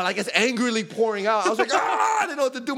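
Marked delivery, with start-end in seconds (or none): 1.7-2.7 mock scream into high-pitched babble; high-pitched frantic wailing, played for laughs; acted panic. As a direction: reenact two helpless brothers freaking out. Acting out helpless panic.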